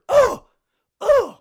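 loud high yelps of pain. Retold or imitated. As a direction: yelp like you got bopped on the head. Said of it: "all of a sudden you heard".

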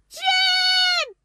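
very high, loud call. Acted. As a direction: call his name out into the dark. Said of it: "دوباره صدا زدم". (فریاد بلند اسم جم)

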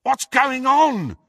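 high, drawn-out shout; shouted question. A narrator's reading. Ron calls to Harry beyond the wall, shouting. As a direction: shout slowly through a wall. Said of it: "shouted Ron again".

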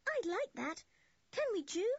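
put-on high, eager girlish plea; delighted. A narrator's reading. Anne asks Julian to accept dinner, delighted. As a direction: plead happily in a high voice. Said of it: "cried Anne in delight".

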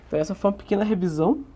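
fast, hurried wrap-up; rushing before cutoff. Real, not acted. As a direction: rush through the sign-off. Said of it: "eu peço desculpa pela pressa".

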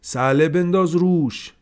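loud, high barked order; shouted command. A narrator's reading. Haji ordering the servant to throw the basket, loud shout. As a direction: yell the order across the yard. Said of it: "فریاد زد".

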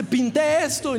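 a lightly voiced proud kid; small son showing off. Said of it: "y dice". (el hijo pequeño enseñando su dibujo, voz aguda y entusiasmada)

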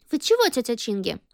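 ordinary delivery, nothing marked

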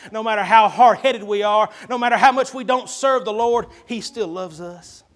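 rising fervent sermon voice; preaching. Real, not acted. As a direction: drive the point home to the church.

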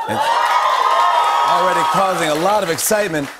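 loud excited announcement; excited shout. Real, not acted. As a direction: shout it out with hype. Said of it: "hyping the movie news".

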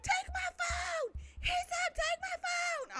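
very high, slow squeal; mock fan pleading. Fake fans begging idol to take phone, mock squeal. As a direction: squeal it drawn-out, imitating desperate fans.

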